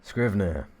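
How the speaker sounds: "Scrivener" said carefully as skriv-ner; loud careful "Scrivener"; first try at Scrivener. Shown as a demonstration. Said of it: "I'm gonna just change up my pronunciation".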